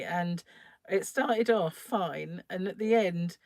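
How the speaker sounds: ordinary talk, nothing marked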